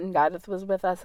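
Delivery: usual speaking voice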